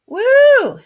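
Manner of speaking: high, loud woo; excited whoop. Real, not acted. Celebrates adding the pattern.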